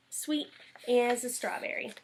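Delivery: ordinary delivery, nothing marked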